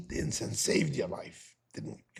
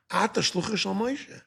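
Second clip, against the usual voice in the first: a touch of mock scorn, slightly raised; half-acted put-down from the angel of death; mild mocking retort; lightly mocking angel; 5 semitones higher than usual. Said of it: "I'm adding a little bit of inflection".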